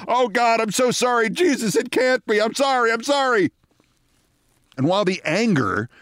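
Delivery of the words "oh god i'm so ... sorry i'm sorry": mortified high-pitched apologizing; Bill Maher. Retold or imitated. Colleague hiding his face in shame, high mock-anguished apology. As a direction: imitate frantic apologies, pitched way up. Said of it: "Bill is shouting apologies".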